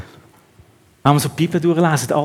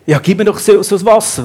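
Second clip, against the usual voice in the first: woman's request; 5 semitones higher, 5 dB louder than usual. Acted. Woman's demand voiced in sermon.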